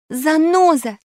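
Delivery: high, loud accusation. A narrator's reading. Alice unmasks the fake Pashka, in a loud voice. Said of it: "заявила она громким голосом".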